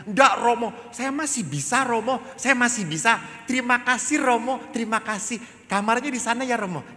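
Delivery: put-on high, loud, insistent child; eager schoolchild. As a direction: politely insist in a little kid's high voice. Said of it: "Saya ketemu anak S D".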